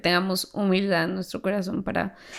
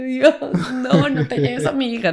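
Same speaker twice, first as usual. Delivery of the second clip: laughing retelling of crying prayer; 4 semitones higher, 4 dB louder than usual. Retold or imitated. Retold desperate prayer while crying.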